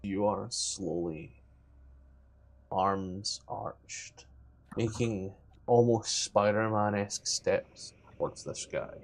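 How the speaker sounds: slow, hushed scene-setting; quiet, drawn-out suspense; game master narration. Acted. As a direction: creep through the words softly, pausing between phrases.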